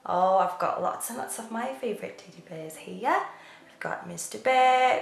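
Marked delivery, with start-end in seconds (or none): none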